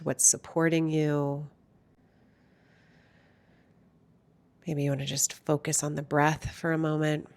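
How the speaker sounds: hushed, soothing lead-in to stillness; guided meditation. Real, not acted. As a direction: gently guide listeners inward, unhurried and calm.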